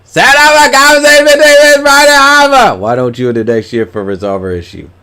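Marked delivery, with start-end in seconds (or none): 0.0-2.8 high, loud, strained reading voice; sudden loud high read. Acted. Streamer reading a message out in loud high voice.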